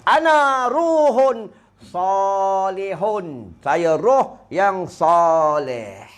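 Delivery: very slow, drawn-out calm reply; pious soul's answer. Retold or imitated. The pious soul answering the angel in the grave, very slow. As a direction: answer slowly as the righteous soul.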